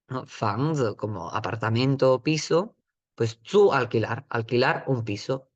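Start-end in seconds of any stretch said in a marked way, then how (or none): none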